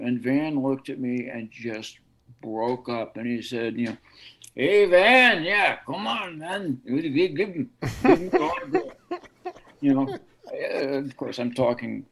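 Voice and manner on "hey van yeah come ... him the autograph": put-on high-pitched Belfast accent, breaking into laughter; amused Belfast mimicry, pitch climbing into laughter at the end; Belfast accent impression. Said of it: "I love that accent".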